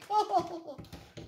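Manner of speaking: high laugh at her own blunder; amused laugh. Real, not acted. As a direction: laugh at yourself. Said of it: "Qu'est-ce que je suis rigolo".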